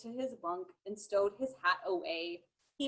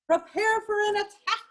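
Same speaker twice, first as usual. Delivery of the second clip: captain's yell; 8 semitones higher, 4 dB louder than usual. Acted. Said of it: "The captain yelled".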